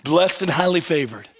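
a hammed-up Christianese reply with a laugh; mock churchy cheer. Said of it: "We're like".

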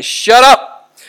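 a forceful shout of Shut up; aggressive rebuke. Shown as a demonstration. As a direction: Shut up barked high and loud. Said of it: "It's aggressive".

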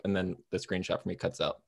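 usual speaking voice

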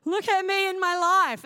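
retold outburst at a friend's advice; indignant outburst. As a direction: throw it back at the friend. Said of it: "My first response back was".

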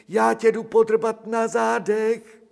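high, sing-song coaxing of a nasty little dog; sing-song cooing to a lapdog. Acted. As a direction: coo it up high like baby talk to a pet. Narrator sweet-talks the dog.

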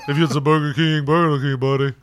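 Shaq impression. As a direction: boom it out in a put-on sports-star voice.

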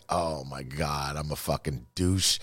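put-on waking up ashamed after a drunken night, slow and soft; ashamed groan. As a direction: drag it out in quiet self-disgust. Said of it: "I wake up with that fucking pit in my stomach".

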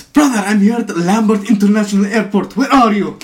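loud, high imitation of an Arabic accent. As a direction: call out in a put-on Arabic accent. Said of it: "the Arabic accent was so great".

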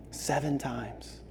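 soft and slow, almost breathed; quiet slow echo; hushed. Acted. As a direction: let it settle in silence. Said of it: "hushed repetition".

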